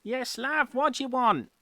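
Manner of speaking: high, loud Cockney barkeep; Cockney pub landlady; Cockney barmaid. Acted. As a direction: play the Cockney landlady calling out. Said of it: "I've gotta see if I can get this accent right".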